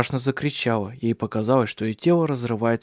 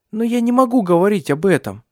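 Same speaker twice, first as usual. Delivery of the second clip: high, loud, pained protest; distressed refusal; 9 semitones higher, 6 dB louder than usual. A narrator's reading. Ursula refusing to recall Alexander's past, distressed. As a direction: burst out as if it is unbearable.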